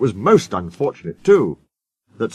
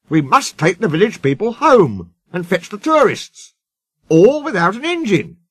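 exasperated; 7 semitones higher, 3 dB louder than usual. A narrator's reading. Controller frets about coaches.

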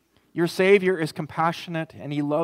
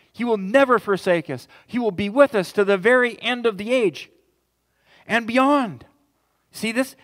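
higher and louder, a rising sermon climax; full-force preaching; 9 semitones higher, 7 dB louder than usual. Acted. Pastor assuring his congregation of eternal life, full-force preaching. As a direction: preach it out to the congregation with growing fire.

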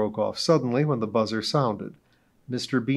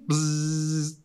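buzzer noise; 5 semitones higher than usual. Acted. Narrator imitates truth buzzer.

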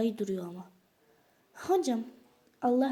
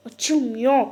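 loud frustration; 3 semitones higher, 13 dB louder than usual. Pretend. Door won't open, repeated.

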